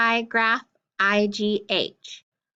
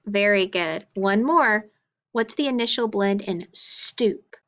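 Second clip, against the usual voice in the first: usual speaking voice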